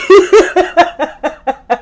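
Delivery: loud high-pitched laugh; real laughing fit; loud high laughter. Real, not acted. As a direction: burst out cackling. Cracking up at quote.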